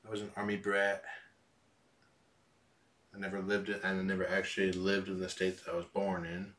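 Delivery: ordinary talk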